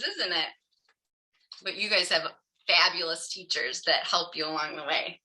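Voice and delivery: usual speaking voice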